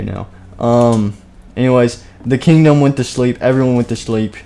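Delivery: ordinary talk, nothing marked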